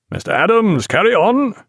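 high, called-out command; captain calling to his clerk; giving an order. A narrator's reading. Captain gives order on deck.

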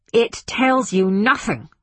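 forceful, angry interruption; barked. A narrator's reading. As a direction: bark it out emphatically. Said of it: "barked Speidel".

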